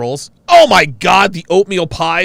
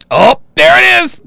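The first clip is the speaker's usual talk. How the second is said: ordinary delivery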